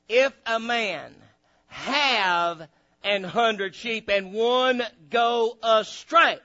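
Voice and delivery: slow, solemn scripture reading. Acted. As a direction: read the verse slowly, weighing it. Reads scripture slowly aloud.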